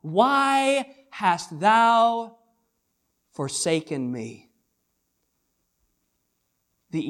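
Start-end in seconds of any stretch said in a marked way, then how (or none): 0.0-4.5 raised cry, louder and higher, slow to the end; loud anguished cry. A narrator's reading. Preacher voicing Jesus crying out on the cross, loud and drawn out. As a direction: cry it out to heaven. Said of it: "He cried with a loud voice".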